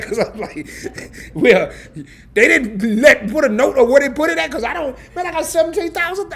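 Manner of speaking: high, loud, laughing rant; half-laughing outburst at the ridiculous instructions; laughing exasperated rant. Real, not acted. Caller laughing in disbelief at support agent, loud exasperated rant. Getting pissed, ranting at agent.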